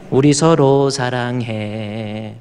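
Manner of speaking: drawn out, very slow and tender; slow tender declaration. Shown as a demonstration. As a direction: say it slowly as a loving vow to the congregation. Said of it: "여러분 같이 시작".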